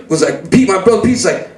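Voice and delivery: ordinary talk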